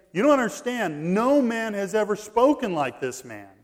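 excited officers. Retold or imitated. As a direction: press the point like amazed guards.

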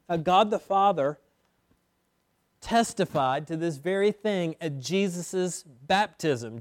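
usual speaking voice

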